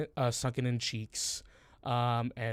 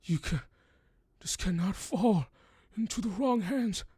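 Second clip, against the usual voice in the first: put-on higher, urgent warning in an old voice; desperate old man; 8 semitones higher than usual. The wounded old man warns Dominic about the box, high and urgent. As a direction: press the warning on them. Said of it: "He just goes".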